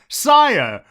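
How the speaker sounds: loud, high call for the king. Retold or imitated. Zazu calling out to the king, loud and high. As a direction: shout to get his attention.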